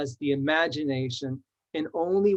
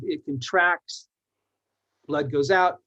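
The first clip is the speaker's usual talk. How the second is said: ordinary talk, nothing marked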